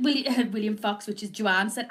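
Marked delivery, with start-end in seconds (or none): none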